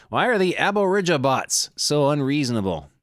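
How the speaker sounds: ordinary talk, nothing marked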